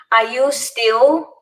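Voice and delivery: usual speaking voice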